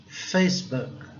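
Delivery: FACEBOOK as two syllables, "FAYSS-buk", no added vowels; English pronunciation. Shown as a demonstration. Teacher showing how they say it abroad, English pronunciation. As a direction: run the S of FACEBOOK straight into a short "book". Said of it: "lá eles falam".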